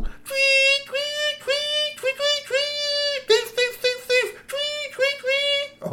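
very high, loud piping whistle cries; shrill piping like a tin whistle crying thief; whistle call. Acted. Pakwatchi blows his whistle to wake the kitchen, piping.

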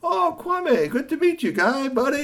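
very high, bright welcome; excited greeting. Acted. Vendor meeting Kwame, very high excited welcome. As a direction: greet the newcomer with delight. Vendor meets new friend.

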